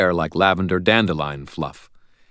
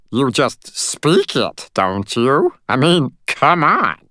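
high, slow, incredulous little fairy; tiny fairy voice; 8 semitones higher, 4 dB louder, slower than usual. Acted. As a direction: squeak it as obvious.

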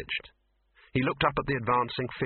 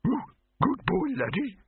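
cooing voice. A narrator's reading. Cooing at dog.

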